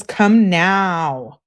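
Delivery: loud, drawn-out retold demand; mock demanding yell. Girlfriend texting her boyfriend to come home, mock demanding yell.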